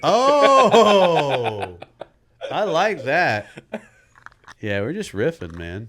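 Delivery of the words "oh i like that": high, loud laughing approval; laughing delight. Real, not acted. Laughs at riffing wordplay.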